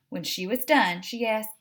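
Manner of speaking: usual speaking voice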